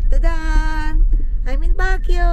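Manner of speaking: loud, high excited announcement. Real, not acted. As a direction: proudly shout the arrival. Excited arrival reveal.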